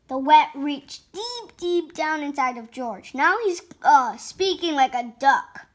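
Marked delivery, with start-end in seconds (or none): none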